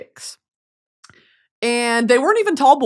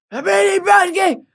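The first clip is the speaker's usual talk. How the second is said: calling out; 9 semitones higher than usual. Retold or imitated. Fans calling from across river.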